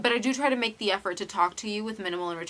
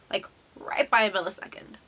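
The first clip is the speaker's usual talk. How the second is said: ordinary talk, nothing marked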